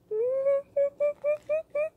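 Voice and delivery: very high giddy squeal; high-pitched thrilled squealing; excited squeal. Real, not acted. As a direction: squeal with excitement in short pulses. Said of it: "I'm so excited".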